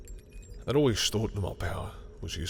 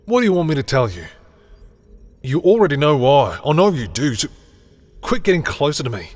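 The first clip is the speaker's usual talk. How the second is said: loud, raised-pitch protest; exasperated outburst; 5 semitones higher, 11 dB louder than usual. Acted. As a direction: snap loudly as if cornered. Acted quarrel, exasperated, cornered.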